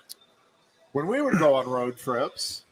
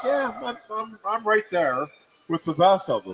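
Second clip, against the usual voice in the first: cocky self-quote; 8 semitones higher than usual. Retold or imitated. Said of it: "it's like".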